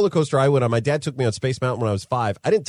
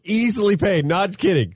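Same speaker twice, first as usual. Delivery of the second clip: usual speaking voice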